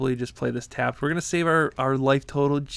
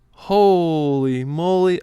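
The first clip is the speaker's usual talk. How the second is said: drawn-out amazed holy moly; 3 semitones higher, 7 dB louder, much slower than usual. Real, not acted. Reacting to the opponent's turn.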